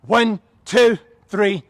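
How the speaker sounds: loud drill-style cadence; shouted count. Real, not acted. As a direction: shout the numbers in steady rhythm. Trainer calls out exercise count.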